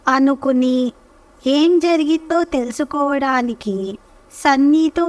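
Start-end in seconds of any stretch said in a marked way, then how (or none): none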